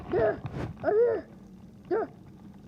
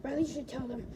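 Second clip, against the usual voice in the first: usual speaking voice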